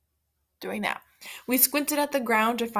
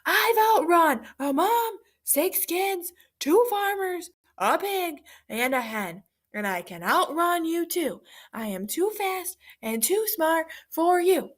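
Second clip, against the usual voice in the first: shouting at top of lungs; 8 semitones higher, slower than usual. Acted. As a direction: shout with all your breath. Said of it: "shouted at the top of his lungs".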